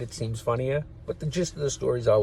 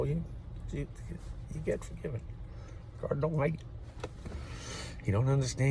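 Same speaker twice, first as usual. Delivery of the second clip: ordinary talk, nothing marked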